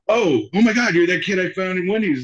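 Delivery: high, loud mock realization; retold burst of delighted recognition; surprised recognition. Director recognizing the kid from Wendy's, surprised.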